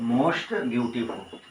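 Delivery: very slow, drawn out repeat. Shown as a demonstration. Teacher to students, repeating the form very slowly. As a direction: repeat it slowly so they can write it. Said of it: "यहाँ हो जाएगा most beautiful".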